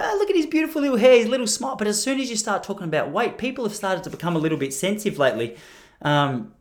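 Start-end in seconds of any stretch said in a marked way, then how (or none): 0.0-1.8 high, quick cooing; gushing admiration. Retold or imitated. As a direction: coo over someone's cute little boy. People cooing over his child.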